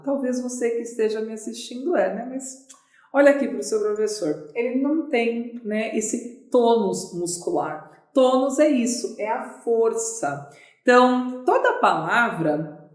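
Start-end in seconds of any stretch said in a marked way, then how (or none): none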